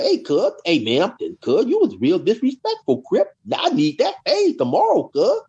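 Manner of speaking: high, swooping imitation of an inmate's challenge; acted confrontation. Inmate challenging the disrespectful youngster to fight tomorrow, imitated confrontation.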